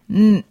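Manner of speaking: "n" cut off sharply; abruptly ended n. Shown as a demonstration. Teacher modelling glottal N for learners, cut-off n. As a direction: chop the "n" short. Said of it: "pronounced as an abruptly ended N".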